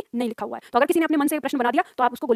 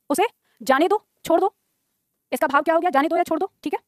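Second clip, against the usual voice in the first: usual speaking voice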